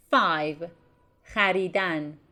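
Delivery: ordinary talk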